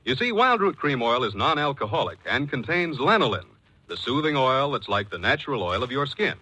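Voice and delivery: ordinary talk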